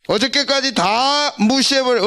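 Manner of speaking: usual speaking voice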